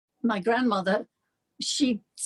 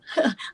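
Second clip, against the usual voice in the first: real laugh; 4 semitones higher than usual. Real, not acted. Guest laughs at praise.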